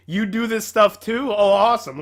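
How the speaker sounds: ordinary delivery, nothing marked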